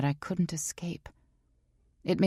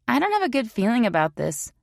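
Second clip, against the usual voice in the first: higher, louder female voice; serious female friend; 8 semitones higher, 4 dB louder than usual. A narrator's reading. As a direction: voice a serious, urgent girlfriend. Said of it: "That was written all over her tone".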